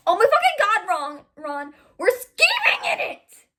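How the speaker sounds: high, loud hammed-up yell; exasperated outburst. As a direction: shout it in overblown exasperation. Exasperated outburst in acted dialogue.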